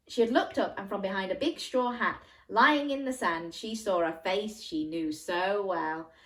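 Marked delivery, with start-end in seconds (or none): none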